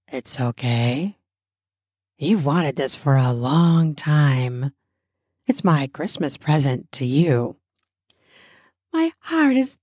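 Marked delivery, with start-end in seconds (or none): 0.1-7.5 low, intimate male whisper; whispering lover. Acted. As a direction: murmur it low into her ear. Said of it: "He whispers in my ear".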